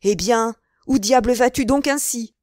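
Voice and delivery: a loud puzzled call from Athos; crying out. A narrator's reading. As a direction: shout at him to stop. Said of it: "lui cria Athos".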